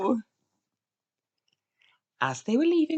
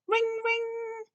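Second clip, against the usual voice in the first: high, level ring ring; phone ring imitation. A narrator's reading. Narrator imitating the phone, high ringing voice. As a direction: chime it like a phone.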